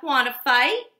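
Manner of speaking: loud taunting question; loud grouchy ladybug dare; grouchy challenge. Acted. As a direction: dare them to fight.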